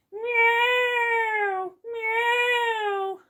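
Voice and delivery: high, loud imitated cat wail; shrill cat yowl. A narrator's reading. Cat startles everyone with a loud wailing yowl. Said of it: "It was shrill and horribly loud".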